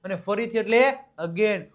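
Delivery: ordinary talk, nothing marked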